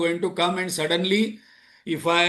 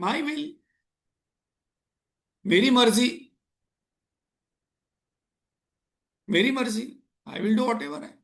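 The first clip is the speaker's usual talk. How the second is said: mock self-willed; 4 semitones higher, faster than usual. Acts out a self-willed person's answer.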